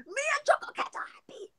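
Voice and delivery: high playful whisper; cute whisper. Pretend. Cute aside after excitement.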